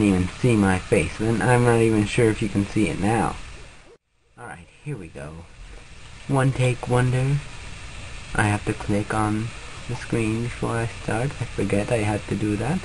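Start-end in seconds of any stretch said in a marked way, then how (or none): 4.4-12.8 hushed talk. Real, not acted. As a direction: keep it hushed as if not to wake anyone. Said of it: "I don't know why I'm talking like this".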